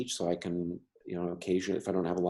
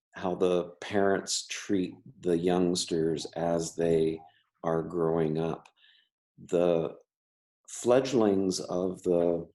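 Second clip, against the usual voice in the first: ordinary delivery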